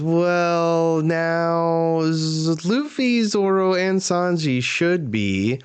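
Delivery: high, stretched-out character voice; high wavering drawl, sliding down at the end; drawn-out acted answer. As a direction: hold the words long and high. Acts out a character's hesitant answer.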